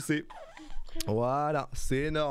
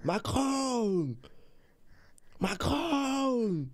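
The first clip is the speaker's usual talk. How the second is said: high, long-held mock shout; playful high holler, falling at the end; mock cry; 9 semitones higher, much slower than usual. Shown as a demonstration. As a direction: call it out slowly for a baby to copy. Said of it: "si tu cries".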